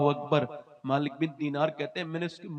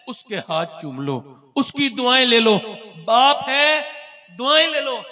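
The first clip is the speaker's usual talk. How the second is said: fervent exhortation; 5 semitones higher, 8 dB louder than usual. Real, not acted. Preacher urges listeners to seek parents' blessings, fervent loud plea. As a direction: plead with the crowd at full force.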